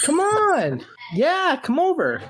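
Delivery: high, drawn-out call to come over; high-pitched, insistent coaxing from across the room. Real, not acted. Host beckons a shy guest to the microphone, calling out high.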